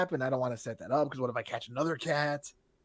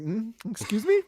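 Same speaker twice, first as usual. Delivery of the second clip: pitched-up, questioning squeak; high-voiced nervousness; 8 semitones higher than usual. Man answering suspicious stranger, nervous high voice. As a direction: act out a startled, rising query. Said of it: "My voice".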